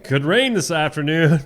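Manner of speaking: loud, high, jolly neighborly holler; mock over-friendly shout that eases off into small talk; Neil. Co-host imitating a neighbor's greeting, hammed-up and loud. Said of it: "Neil's the one like".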